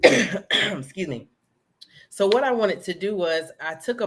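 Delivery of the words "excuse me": slow, rough clear of the throat before apologizing. Real, not acted. Guest clearing her throat mid-answer, rough and slow. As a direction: clear the throat and excuse yourself. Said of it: "I'm in here choking".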